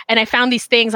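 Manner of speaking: ordinary delivery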